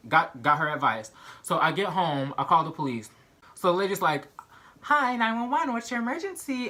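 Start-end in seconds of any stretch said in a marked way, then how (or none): 4.8-6.7 911 operator. Retold or imitated.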